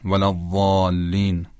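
"ضالین" with emphatic Arabic ḍād, long drawn-out aa; slow, careful Arabic articulation of "ولا ضالین"; Quranic recitation pronunciation. Shown as a demonstration. Teacher citing the word from prayer, slow emphatic Arabic "ضالین". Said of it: "ضالین که در نماز هست".

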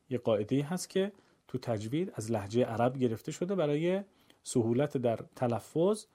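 usual speaking voice

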